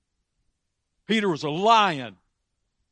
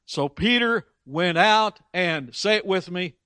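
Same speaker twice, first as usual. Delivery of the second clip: ordinary delivery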